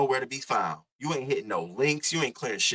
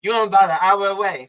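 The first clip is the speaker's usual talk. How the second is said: imitating a louder, higher, hurried challenge; mock phone threat; 4 semitones higher, 7 dB louder, faster than usual. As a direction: throw it out like her heated threat. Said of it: "Reed on the phone like this".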